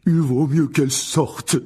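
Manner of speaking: loud, slow, hearty big man; cheerful. A narrator's reading. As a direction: boom it out cheerfully. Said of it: "d'un ton joyeux". (ton joyeux, fort et posé)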